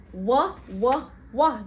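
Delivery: wah like wow, loud; wah sound. Shown as a demonstration. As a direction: call wah, wah. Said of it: "Just say wow".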